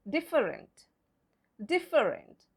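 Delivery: Different in three syllables, DIF-fer-ent; wrong spelling pronunciation. Shown as a demonstration. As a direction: sound the middle E of Different. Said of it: "how do we mispronounce it".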